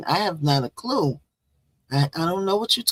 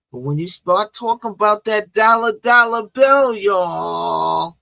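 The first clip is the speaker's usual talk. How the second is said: louder and higher, stretch out dollar bill; hyped-up money pitch; 4 semitones higher, 7 dB louder than usual. Acted. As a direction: sell the word dollar like a hype man. Hyping up talk of money.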